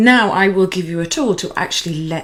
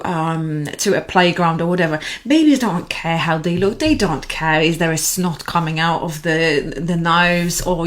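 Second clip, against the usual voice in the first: usual speaking voice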